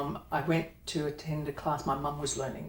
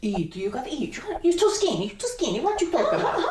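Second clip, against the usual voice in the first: high, fussing Italian grandma; Italian nonna; 10 semitones higher, 5 dB louder, faster than usual. Acted. Nonna urging skinny grandson to eat, hammed-up Italian nonna. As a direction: nag like an over-the-top Italian nonna. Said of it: "I'm doing my Italian nonna".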